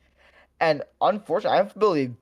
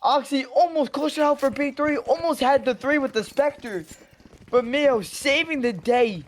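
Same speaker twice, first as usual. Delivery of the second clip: excited esports caster; 7 semitones higher than usual. Real, not acted. Co-caster reacting to the clutch.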